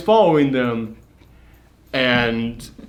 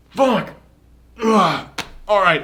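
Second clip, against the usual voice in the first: raised pitch, frustrated outburst; exasperated giving in; 7 semitones higher, slower than usual. Acted. Supposed monster drops his act, exasperated. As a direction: act out reluctant, fed-up surrender.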